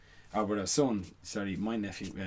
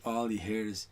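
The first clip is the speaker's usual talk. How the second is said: normal talking voice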